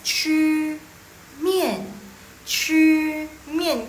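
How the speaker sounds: "chu" held high and level, "mian" falling hard; teacher's separated syllables on "chu mian"; model pronunciation. Shown as a demonstration. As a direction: drill "chu mian" loud and slow. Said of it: "Jadi yang next".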